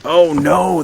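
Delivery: loud, high "oh no" of sudden alarm; alarmed cry. Real, not acted. Gamer sees where the enemies come from, loud alarmed cry. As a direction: yelp it as the horde appears.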